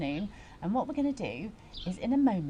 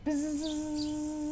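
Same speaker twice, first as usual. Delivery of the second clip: steady, higher-pitched sustained buzz; buzzing bee; 5 semitones higher, 4 dB quieter than usual. Shown as a demonstration. Teacher showing children the bee sound, buzzing. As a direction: hum a long buzz like a bee. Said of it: "make a sound like a buzzing bee".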